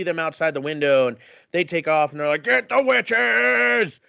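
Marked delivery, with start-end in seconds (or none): 2.4-4.0 drawn-out, higher mock mob cry; mock mob yell, stretched long. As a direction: ham up an angry villager. Retold mob calling out.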